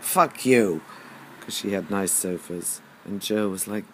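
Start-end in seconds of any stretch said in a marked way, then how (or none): 0.0-0.8 a half-loud jokey swear; replaying an old retort, louder than the chat around it; mock rude retort. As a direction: toss it back like a cheeky insult. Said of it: "I think I said something like".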